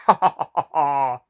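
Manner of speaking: loud hearty cackle; jolly cackle. Acted. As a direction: chuckle at your own tale. Narrator's merry sign-off laugh.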